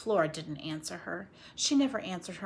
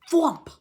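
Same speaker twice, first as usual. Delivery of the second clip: punchy louder sound effect; loud thwomp; 7 semitones higher, 11 dB louder than usual. A narrator's reading. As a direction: slam the word out.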